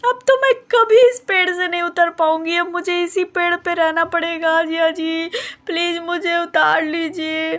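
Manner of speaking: put-on little girl on the tree pleading with her brother-in-law, near tears; tearful pleading. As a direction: beg through tears to be lifted down. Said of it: "काहे रो रही हो भाई".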